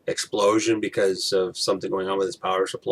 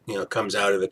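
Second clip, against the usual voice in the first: usual speaking voice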